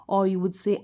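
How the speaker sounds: normal talking voice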